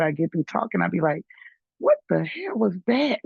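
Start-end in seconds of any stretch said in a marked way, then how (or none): 1.8-3.2 higher-pitched mock disbelief; baffled at yourself, playfully high; amazed self-quote. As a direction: retell your own astonishment, pitch jumping up. Quotes own amazed reaction to herself.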